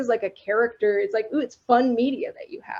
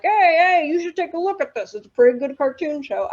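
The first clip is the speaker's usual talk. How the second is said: mock executive voice; 6 semitones higher than usual. Executive passing a deck to a colleague, hammed-up exec voice. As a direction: play a slick studio exec tipping off a colleague. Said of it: "I don't know where that accent came from".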